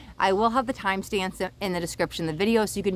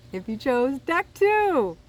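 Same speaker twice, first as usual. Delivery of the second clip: high, drawn-out excited announcement; slow singsong thrill, pitched up; real excitement; 6 semitones higher, slower than usual. Real, not acted. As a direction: stretch it out with rising glee. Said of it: "why it just got me so excited".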